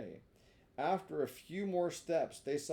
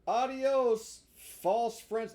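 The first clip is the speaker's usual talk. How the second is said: Pinocchio dismissing; 8 semitones higher, 8 dB louder, much slower than usual. Acted.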